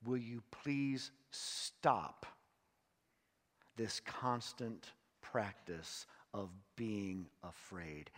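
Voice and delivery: slow, softening plea fading away; gentle plea. Shown as a demonstration. Pastor voices God pleading with his fearful people, slow and gentle. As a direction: coax them to let go of fear, slowly. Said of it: "What God says is".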